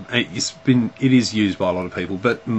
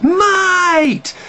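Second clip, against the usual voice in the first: very high, loud "Mate!" greeting; old friend greeting you, thrilled and ready for a piss up; overjoyed shout; 8 dB louder than usual. Retold or imitated. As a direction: yell it like you've spotted a long-lost mate. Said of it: "someone comes up to you and goes".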